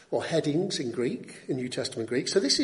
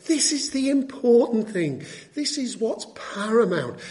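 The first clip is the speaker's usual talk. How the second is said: higher, insistent sermon delivery; emphatic preaching; 5 semitones higher than usual. Real, not acted. Preacher urging Christians on what they must grasp, emphatic preaching. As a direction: drive each point home, building phrase on phrase. Preacher drives home the point.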